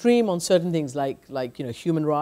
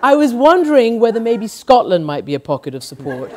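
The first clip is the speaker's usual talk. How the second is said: ordinary talk, nothing marked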